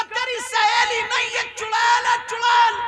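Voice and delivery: high loud alarmed shout; a mother shouting that the friend is a witch; mother's shout. Retold or imitated. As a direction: yell the warning at the daughter.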